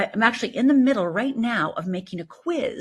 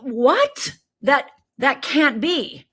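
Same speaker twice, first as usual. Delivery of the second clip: imitating shock; 5 semitones higher, 4 dB louder, much slower than usual. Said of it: "they're like".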